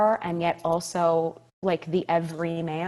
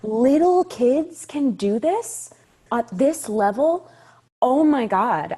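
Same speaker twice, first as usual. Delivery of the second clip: high, slow, louder gasp of wonder; amazed disbelief; 7 semitones higher, 6 dB louder, slower than usual. Retold or imitated. Young fan stunned watching child actors: high amazed disbelief. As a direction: marvel at the child actors, drawn out. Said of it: "And I was like".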